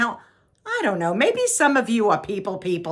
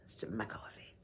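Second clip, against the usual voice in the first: very soft, low whisper; hushed, barely voiced murmur; whispered aside; 10 semitones lower, 21 dB quieter than usual. Real, not acted. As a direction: whisper it to yourself.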